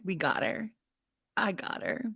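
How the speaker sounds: very soft, self-satisfied mock murmur; imagined smug commenters. Imitating commenters after they post, soft mock self-congratulation. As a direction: quietly congratulate yourself, in imitation. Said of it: "literally are like".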